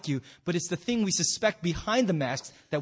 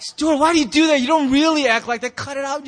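loud, high acted girlfriend's telling-off; exasperated scolding; 6 semitones higher, 8 dB louder than usual. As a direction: scold him, fed up. Girlfriend keeps scolding her boyfriend.

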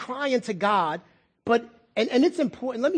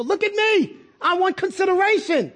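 high, insistent demand; demanding consideration; 7 semitones higher than usual. Acted. Spouse demanding attention from partner, high insistent cry. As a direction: insist on attention, pitched right up. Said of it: "We do that with our spouses".

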